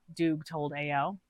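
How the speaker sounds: usual speaking voice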